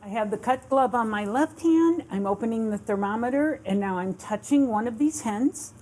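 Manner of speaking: ordinary talk, nothing marked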